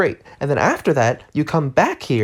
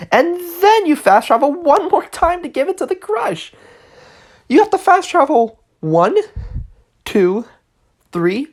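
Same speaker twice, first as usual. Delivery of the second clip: exasperated; 10 semitones higher than usual. Real, not acted. Ranting about endless fast travel.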